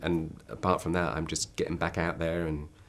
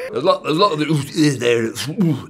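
louder, higher booming giant; menacing giant voice; 8 semitones higher, 10 dB louder than usual. Acted. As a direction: keep the giant menace going. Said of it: "That is actually quite menacing".

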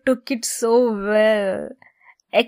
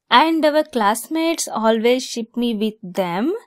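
ordinary delivery

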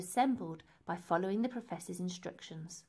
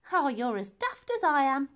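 chuckling; 5 semitones higher, 8 dB louder than usual. Acted. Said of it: "chuckled Dotty".

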